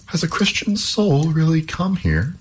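high, incredulous question; astonished princess. A narrator's reading. Princess spinning alone sees a stranger come in, astonished. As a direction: ask it high, in disbelief.

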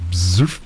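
short staccato bee buzz; shrill buzz. Acted. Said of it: "final shrill staccato".

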